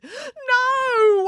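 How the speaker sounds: long, high-pitched wail; whiny drawn-out cry. A narrator's reading. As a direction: wail it out in protest. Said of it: "wailed Matilda".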